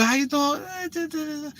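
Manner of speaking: high, soft, very drawn-out mock greeting; put-on proper talker. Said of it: "I speak everything good".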